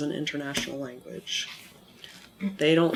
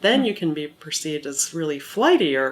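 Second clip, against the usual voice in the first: too-wide pitch range; 5 dB louder than usual. Shown as a demonstration. Teacher hamming up an overly wide range, flighty swooping intonation. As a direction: swing the pitch up exaggeratedly on "wide" and "unstable". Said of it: "if your range is too wide".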